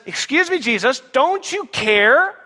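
high, drawn-out accusing question; indignant. Acted. Voicing indignant Martha confronting Jesus.

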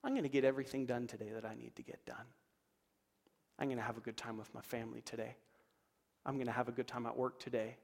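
soft, fast whisper of daily affirmations; whispered declaration. Shown as a demonstration. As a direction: breathe the promise to yourself.

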